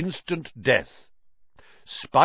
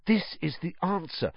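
breathed excitedly; 6 semitones higher, 5 dB quieter than usual. Acted. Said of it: "he breathed".

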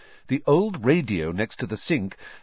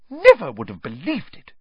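scandalised grumble, higher and louder; muttered indignation; 8 semitones higher, 5 dB louder than usual. A narrator's reading. Mother grumbling at her sons over breakfast, indignant muttering. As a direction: grumble in disbelief at their behaviour. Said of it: "she muttered things like".

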